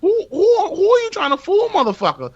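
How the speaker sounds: high-pitched, stammered indignation; incredulous outrage. Real, not acted. Host challenging the tofu makers, incredulous outrage. Outraged at vegan tuna label.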